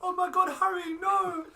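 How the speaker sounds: put-on overdone girl's alarm; mock Hermione. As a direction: scream in hammed-up panic. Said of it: "makes Hermione, like, go".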